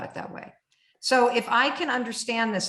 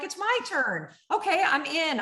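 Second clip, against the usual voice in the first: psyching up; 8 semitones higher than usual. Retold or imitated. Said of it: "and I'm like".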